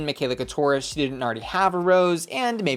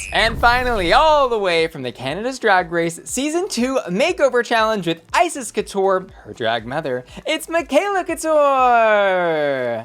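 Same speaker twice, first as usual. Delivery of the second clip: announcer intro; 6 semitones higher, 4 dB louder than usual. Acted. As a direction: bellow the name like a pageant host. Big announcer-style queen introduction.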